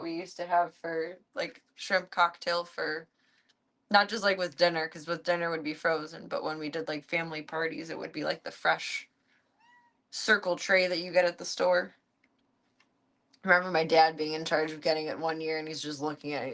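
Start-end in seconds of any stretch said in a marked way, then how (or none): none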